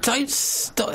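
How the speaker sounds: very high squeaked exclamation; squeaky high voice. Pretend. Sudden high-pitched interjection.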